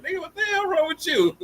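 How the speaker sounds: very high mock baby voice, indignant; baby's indignant look. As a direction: voice the toddler outraged at the driver. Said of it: "He looking at me like".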